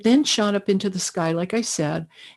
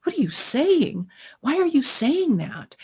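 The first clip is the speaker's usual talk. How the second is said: startled double take; 6 semitones higher than usual. Retold or imitated. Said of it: "I even did a double take with myself".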